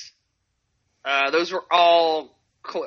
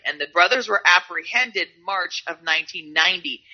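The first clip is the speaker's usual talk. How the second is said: usual speaking voice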